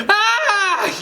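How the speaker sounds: loud, very high scream; high-pitched startled shriek; tripping scream. A narrator's reading. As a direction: scream as you trip and fall. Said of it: "you cry as you trip".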